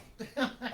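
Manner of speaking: quick high laugh; short laugh. Acted. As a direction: burst out laughing. Laugh after canteen.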